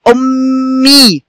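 "أمي" slowly, with a long hummed m and drawn-out ee; doubled m demonstration. Shown as a demonstration. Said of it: "قلنا صوت ميم ساكنة".

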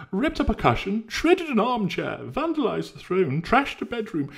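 very high, loud hammed-up damsel voice; mock horror. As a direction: overplay the victim, shrill with fake distress. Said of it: "in mock horror".

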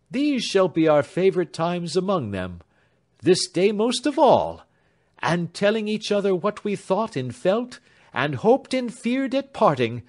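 mean every word of the farewell; lightly voiced young man. A narrator's reading. Alfred to his beloved at parting, earnest.

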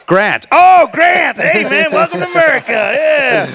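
a big mock yell of welcome, like an overexcited American. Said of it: "And I'm like".

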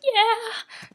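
a high, loud, thrilled yeah; excited yeah. Retold or imitated. Answering friends about her new haircut, thrilled and loud. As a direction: burst out happily.

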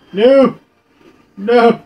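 high, loud panicked no. Real, not acted. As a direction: cry out in protest. Getting hit in game.

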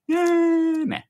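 high, loud, stretched Aussie "yeah" then short "nah"; hammed-up Australian squeal; Aussie accent. Clowning for friend's laugh, exaggerated Aussie accent. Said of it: "Everybody's just saying".

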